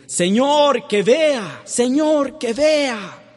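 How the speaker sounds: high, loud, desperate cry of Señor; blind man's plea. Retold or imitated. Preacher voicing the blind man begging Jesus, high loud plea. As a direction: beg to see with all your voice. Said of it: "cuando le decía el ciego".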